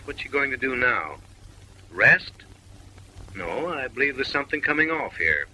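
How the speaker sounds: ordinary talk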